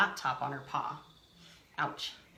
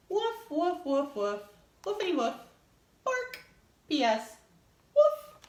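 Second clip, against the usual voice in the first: high, hammed-up dog barks; barking dog voice; 9 semitones higher, 4 dB louder than usual. Reading the dog's note aloud as a yappy pup. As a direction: bark it out like a puppy writing a note.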